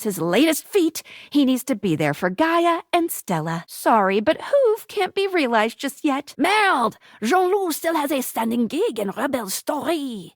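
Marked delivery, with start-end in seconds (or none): 6.4-10.3 put-on exclamation, then a French-accented game line. Game narrator reporting on Jean-Loup's gigs, French accent.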